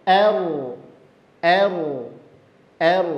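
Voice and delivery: "Er" with a thin, smiling a; bunyi a tipis. Shown as a demonstration. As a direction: say "er" with a spread smile, rolled r. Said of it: "Senyum a-nya". ("Er" dengan a tipis sambil senyum)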